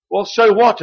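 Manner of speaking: ordinary talk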